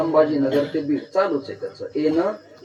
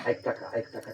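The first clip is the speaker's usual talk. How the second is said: gentle call; 4 semitones lower, 11 dB quieter than usual. Acted. Gently calling to the clock.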